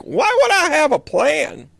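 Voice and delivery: high, slow mock voice stretching would; careless knight. Reader mocking the Knight of Swords, high and slow. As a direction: drawl it like a knight who never plans. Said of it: "They're like".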